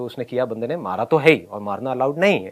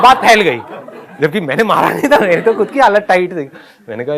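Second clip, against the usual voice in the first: usual speaking voice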